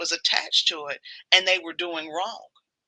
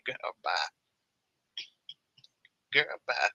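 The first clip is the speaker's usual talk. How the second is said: dismissive; 12 semitones lower, 6 dB quieter, faster than usual. Pretend. Dismissive retort.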